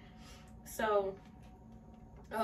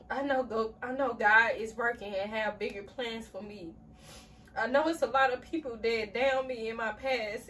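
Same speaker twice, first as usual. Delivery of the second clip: louder, tear-filled journal reading; emotional reading; 3 semitones higher, 6 dB louder than usual. Real, not acted. Reading a journal entry aloud, voice emotional and tearful. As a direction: read on though the tears keep coming. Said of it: "I did not expect to cry".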